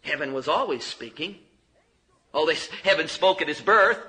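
normal talking voice

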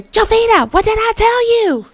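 high, loud scolding shout; mama screaming at her daughter caught cooking; retold yell. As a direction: yell it straight into her ear like an angry mama. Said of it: "yells in my ear".

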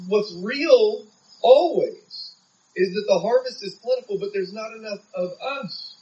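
normal talking voice

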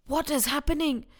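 plain reading voice

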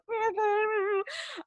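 high muffled squeal behind the hand; hand over mouth. Retold or imitated. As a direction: re-enact a stifled, high-pitched noise. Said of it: "had my hand over my mouth".

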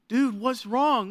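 high, drawn-out mock concern; retold alarmed dad. Dad to his crying baby, retold alarm. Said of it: "at this point, I'm like".

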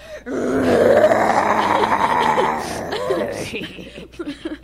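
big shrieking laugh. Acted. Dragon laughs at the joke.

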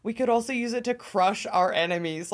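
normal talking voice

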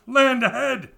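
high, loud lookout call; shouting. A narrator's reading. The crew announcing the island, a loud high call. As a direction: call it across the deck. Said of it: "they shouted".

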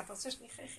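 barely audible, obliging offer; tiny submissive murmur; acted willing question. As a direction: ask very softly, ending on a rise. Queen asking what king wants.

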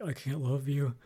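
sleep talking. Retold or imitated. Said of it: "he's saying in his sleep".

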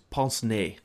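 PINCE-NEZ said as "pahns-nay"; French pronunciation. Shown as a demonstration. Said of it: "You gotta think French".

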